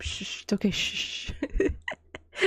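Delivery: rhythmic shushing. Acted. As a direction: hush them gently, rhythmically. Soothing the worried partner.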